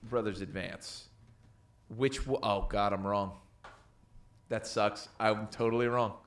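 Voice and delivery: normal talking voice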